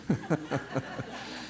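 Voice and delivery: airy, breathy chuckle; amused laugh. Retold or imitated. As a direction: laugh off the idea. IRS agent laughing at him.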